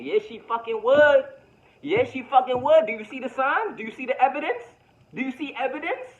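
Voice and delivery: high, loud comeback to the doubter; put-on insistent voice. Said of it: "switching my voice around".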